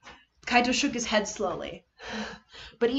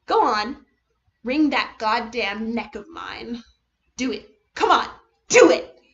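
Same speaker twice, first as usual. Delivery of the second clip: goading taunt; 7 dB louder than usual. Acted. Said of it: "who served only to goad her on".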